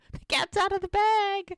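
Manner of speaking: very high, playful singsong; mock uh-oh. Podcaster jokes about the revealed secret, high singsong. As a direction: tease it out in a high voice.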